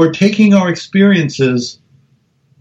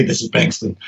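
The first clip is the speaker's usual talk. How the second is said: low, quiet reply; 6 semitones lower, 7 dB quieter than usual. Retold or imitated. Sleepy scientist answering the phone, low and quiet. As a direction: answer low and soft, matching him. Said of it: "and I would say".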